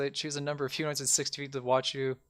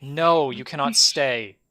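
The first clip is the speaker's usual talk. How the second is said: slow, louder, deliberate refusal; firm refusal; 2 semitones higher, 8 dB louder, much slower than usual. Acted. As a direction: lay down the law, word by word. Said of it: "goes like".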